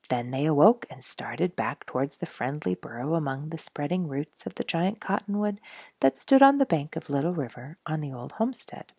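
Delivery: ordinary delivery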